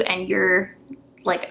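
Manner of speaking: ordinary delivery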